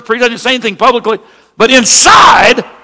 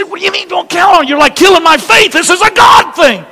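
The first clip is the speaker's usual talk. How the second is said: higher, pushing back hard at the doubt; indignant protest; 8 semitones higher than usual. Retold or imitated. Son protesting his father's doubt, indignant higher voice. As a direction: object like a hurt young believer.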